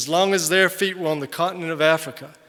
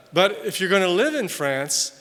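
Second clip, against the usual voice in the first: ordinary talk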